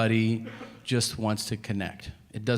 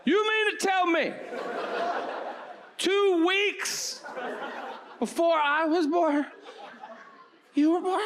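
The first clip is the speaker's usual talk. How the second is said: mock astonished; slower than usual.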